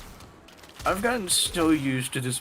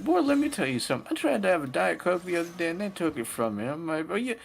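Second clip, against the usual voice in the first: put-on high, fast Southern granny accent; Southern grandma accent; 10 semitones higher than usual. Southern grandma defending her soda, high and fast in accent. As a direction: sass it out like a feisty Southern granny. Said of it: "she's Southern in my explanation".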